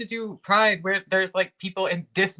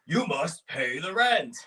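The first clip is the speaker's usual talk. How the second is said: slow, menacing melodrama villain; slower than usual. Acted. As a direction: sneer it like a mustache-twirling landlord. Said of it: "the classic".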